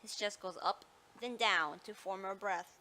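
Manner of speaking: normal talking voice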